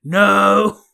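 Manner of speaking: loud, drawn-out imitated yell; mock scream. Imitating Wyatt standing up under gunfire, loud mock scream. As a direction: belt it out like a movie hero. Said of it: "starts screaming".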